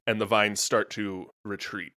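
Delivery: ordinary talk, nothing marked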